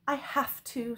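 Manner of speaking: sigh-free, self-sacrificing resolve, pitched high; noble and long-suffering. A narrator's reading. Princess pretending sacrifice to the knight, long-suffering. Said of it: "trying to sound noble and long-suffering".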